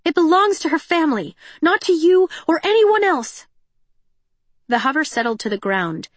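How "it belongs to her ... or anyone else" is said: high, loud defiant shout. A narrator's reading. Cinder shouting at police hover.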